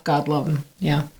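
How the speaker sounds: ordinary delivery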